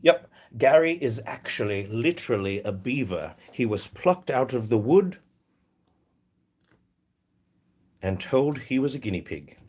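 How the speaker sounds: put-on English accent, played straight; dead-serious sarcasm in an English accent; mock English accent, no hint of joking; English dry sarcasm. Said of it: "they would say something like".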